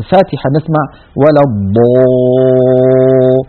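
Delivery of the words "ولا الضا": الضا with the alif drifting toward o, very slow; rounded alif. Shown as a demonstration. Teacher imitates the wrong rounded alif. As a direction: stretch الضا with pursed lips. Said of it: "يظن القارئ أنه يفخم الألف".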